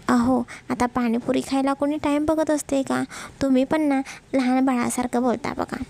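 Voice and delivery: usual speaking voice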